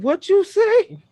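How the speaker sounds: high, loud and slow outraged challenge; dad catching his daughter cussing; stern dad. Retold or imitated. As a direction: drag it out like a warning to the kid. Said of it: "I said".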